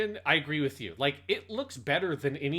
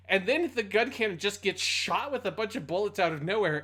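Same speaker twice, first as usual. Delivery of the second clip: usual speaking voice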